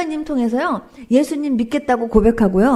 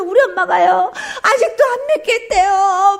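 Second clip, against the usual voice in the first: retold tearful complaint; 6 semitones higher, 3 dB louder than usual. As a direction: cry it out to God in imitation. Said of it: "울면서".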